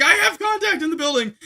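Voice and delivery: high, loud call to the team; yelling over shoulder. Retold or imitated. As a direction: shout the contact report. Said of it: "yelling over my shoulder".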